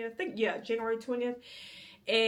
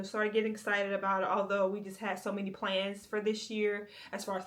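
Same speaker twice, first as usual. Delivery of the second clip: ordinary talk, nothing marked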